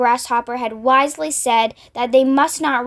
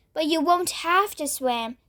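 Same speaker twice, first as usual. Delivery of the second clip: ordinary delivery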